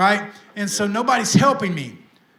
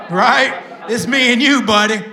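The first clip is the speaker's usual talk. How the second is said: laughing call-out; 3 semitones higher, 4 dB louder than usual. Real, not acted. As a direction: call him out fondly while laughing. Laughing call to old friend.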